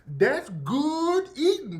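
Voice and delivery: high, slow and drawn out; slow savoring drawl. Retold or imitated. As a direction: savor every word, slowly. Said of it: "going overboard with the accent".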